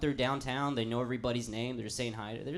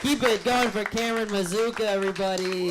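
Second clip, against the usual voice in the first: raised, high crowd call; host's applause call; 6 semitones higher, 4 dB louder than usual. Real, not acted. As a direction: call for applause over the clapping. Next comic hails the previous one.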